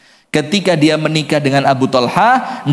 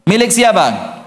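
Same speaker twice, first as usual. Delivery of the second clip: fervent preaching; 8 semitones higher than usual. Acted. Preacher challenges the congregation, raised and fervent. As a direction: press the congregation with each question.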